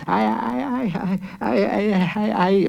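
put-on high, halting old judge voice; stammering judge. A narrator's reading. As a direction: stutter like a flustered judge.

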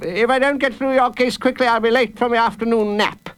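high-pitched, fussy judge; impatient judge. Acted. Judge anxious for his afternoon nap, testy. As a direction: fuss pompously in a high voice.

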